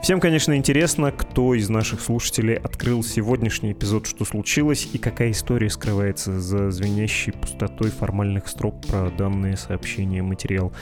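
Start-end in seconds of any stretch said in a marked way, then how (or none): none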